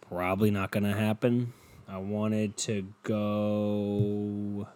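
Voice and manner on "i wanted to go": very slow with GO stretched long; drawn-out. Real, not acted. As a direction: hold the word "go" in one long level note. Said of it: "It sounds so slow".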